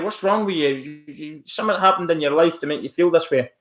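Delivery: ordinary delivery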